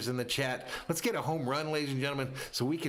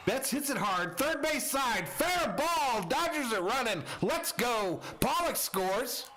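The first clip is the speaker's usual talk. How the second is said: high-pitched, thrilled live baseball call; excited play-by-play; 10 semitones higher than usual. Real, not acted. Announcer calls Betts's scoring hit to fans, excited play-by-play.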